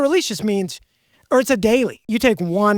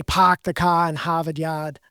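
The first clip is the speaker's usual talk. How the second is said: slow, lower Massachusetts accent demo; 4 semitones lower than usual. Shown as a demonstration. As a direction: show off a Mass accent, dropping the R's. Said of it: "show the mass accent".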